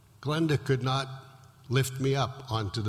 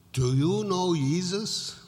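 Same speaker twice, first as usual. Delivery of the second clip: put-on higher, slow South American accent; a nurse's thick South American accent, higher and slow; 6 semitones higher, slower than usual. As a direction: ask it slowly in a heavy South American accent. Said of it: "in a very thick South American accent".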